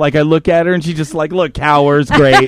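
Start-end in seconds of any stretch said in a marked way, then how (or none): 2.1-2.5 "Great" broken by a laugh; rueful laughing "great". Real, not acted. Laughing at himself.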